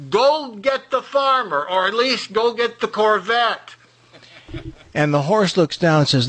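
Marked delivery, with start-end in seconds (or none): 0.1-3.7 high squeaky chicken yelling up from a hole. Acted. Chicken in the sinkhole yelling up to the horse, high squeaky voice. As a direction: play a panicky little chicken, much higher.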